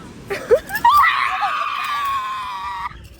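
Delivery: loud high shrieking laughter. Real, not acted. Bird snatched the bread.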